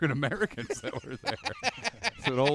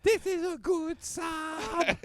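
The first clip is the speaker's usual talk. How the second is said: squeaky monkey voice; 14 semitones higher, much slower than usual. Retold or imitated. Mock monkey voice.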